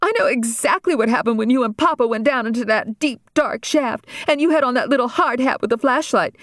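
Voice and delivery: put-on sick-and-tired teenage rant. Daughter complaining to her family, mildly exasperated. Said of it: "Regina became indiscreet".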